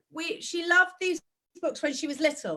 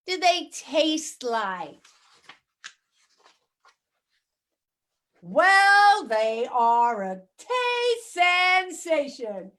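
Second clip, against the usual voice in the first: put-on crazy American accent; slower than usual. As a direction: read the pigeon story in an over-the-top American accent. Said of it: "I used to do it with a very crazy American accent".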